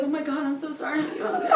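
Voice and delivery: nervous boyfriend imitation. Boyfriend apologizing to his soaked girlfriend, imitated nervously. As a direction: imitate an anxious, hushed apology. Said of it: "he was all nervous".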